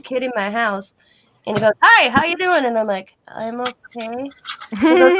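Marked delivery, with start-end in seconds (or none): 1.8-2.6 friendly hello in a higher pitch; imitating a kid's breezy, higher-pitched greeting; upbeat greeting. Strange kid greets her brightly. 3.3-4.3 slow, soft, rising uncertainty; hesitant reply. Retold or imitated. Wary reply to strange kid.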